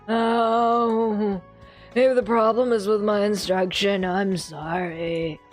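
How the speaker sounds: put-on slow hurt apology from an old man. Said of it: "he's still getting the old man voice".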